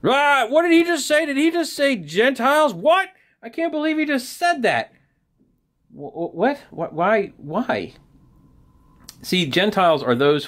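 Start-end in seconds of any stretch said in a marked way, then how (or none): 0.0-4.9 high-pitched, hammed-up indignation; outraged crowd. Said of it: "They just got so angry". 5.9-7.9 mock sputtering disbelief. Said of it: "They just got so angry".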